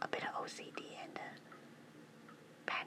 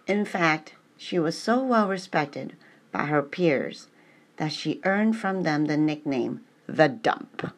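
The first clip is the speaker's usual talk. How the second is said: reading aloud; 19 dB louder, slower than usual. Acted. Reads a text aloud loudly.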